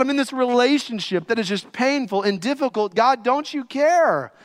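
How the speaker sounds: high, loud desperate cries to God; preaching at full force. Acted. Preacher voicing a desperate believer crying to God.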